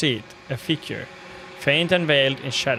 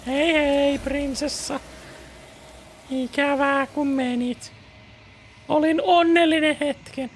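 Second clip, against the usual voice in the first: high character voice; 12 semitones higher than usual. Acted. Voices the bird character in Finnish.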